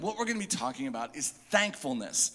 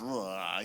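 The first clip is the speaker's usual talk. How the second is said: disgusted ugh; 9 semitones lower, 6 dB quieter than usual. Retold or imitated. Disliked candy.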